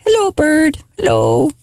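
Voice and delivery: high sing-song coo, hello bird; talking sweetly to a parrot. Retold or imitated. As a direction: coax the bird in a high baby voice. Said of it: "he's like".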